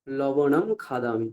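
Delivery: লবণম খাদামি read slowly, each syllable clear; slow Sanskrit model phrase. Shown as a demonstration. Teacher slowly modelling Sanskrit for students, careful. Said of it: "ল দিয়ে".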